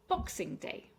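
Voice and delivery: "Boxing Day" with the weight on Box; stress on BOX. Shown as a demonstration. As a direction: hit BOX in "Boxing Day", let the rest drop. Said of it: "stress on the box".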